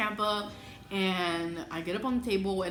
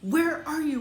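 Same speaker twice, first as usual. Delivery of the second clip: higher, louder urgent demand; 8 semitones higher, 6 dB louder, slower than usual. Retold or imitated. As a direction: call out for her missing husband. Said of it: "texting him, and I'm like".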